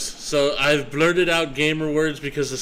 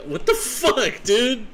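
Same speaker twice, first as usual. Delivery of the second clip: exasperated catchphrase; 9 semitones higher, 3 dB louder than usual. Acted. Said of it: "Rogue would say".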